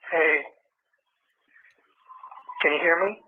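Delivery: ordinary talk, nothing marked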